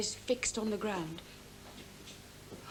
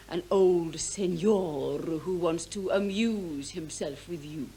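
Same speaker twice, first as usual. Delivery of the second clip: slow, low, lingering over each word; drawn-out mockery; 8 semitones lower, 3 dB quieter, slower than usual. Daughter describing the old senor's proposition, mocking. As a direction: draw it out with scornful relish.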